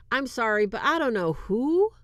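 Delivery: ordinary talk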